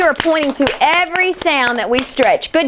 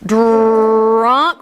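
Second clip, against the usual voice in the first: put-on Drunk said in one quick run; quicker blend; 3 semitones lower than usual. Shown as a demonstration. Teacher blending the word quicker for the class. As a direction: blend Drunk together faster. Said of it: "A little quicker".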